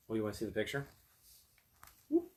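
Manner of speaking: plain reading voice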